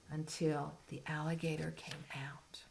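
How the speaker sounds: soft suspense whisper. Real, not acted. Retelling suspense to child.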